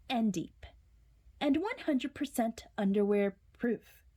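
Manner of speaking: ordinary delivery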